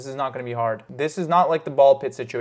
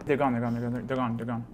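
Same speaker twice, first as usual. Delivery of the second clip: soft, fading repeated relief; hushed relief; 2 semitones lower, 9 dB quieter than usual. Real, not acted. Gamer whispers as enemies pass by. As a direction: let it trail off in relief.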